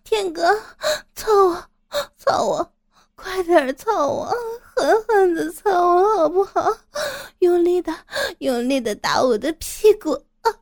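high, loud, moaning demands; crying out. Acted. Woman calls to her lover, crying out. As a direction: cry out urgently, high and breathless. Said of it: "圆圆立刻大叫道".

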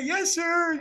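put-on very high, childlike reply; Girl Scouts answering politely, very high; little girls' voice. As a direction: squeak it like small girls.

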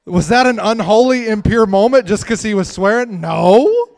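loud, high rhetorical challenge; preaching at full force. Real, not acted. Preacher challenging his congregation, loud and high. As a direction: hammer the question home.

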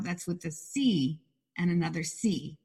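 ordinary talk

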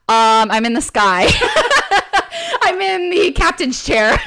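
giggling through a dumb answer; quoting a silly reply while cracking up; half-laughing mock innocence. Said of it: "regular people are like".

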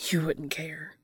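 whispered, unvoiced thought; inner thought. A narrator's reading. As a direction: breathe it to yourself. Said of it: "Dean thought".

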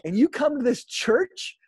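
high, loud and slowed, thrilled question; excited disbelief. Retold or imitated. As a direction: ask it beaming with delight. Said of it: "And I'm like".

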